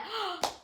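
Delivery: high surprised intake of breath; sharp gasp. Retold or imitated. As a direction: gasp in shock. Seeing the result on scorecard.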